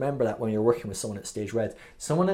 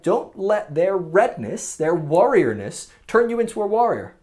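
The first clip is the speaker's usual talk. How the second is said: emphatic warning; 6 semitones higher, 8 dB louder than usual. Real, not acted. Urging listeners not to react.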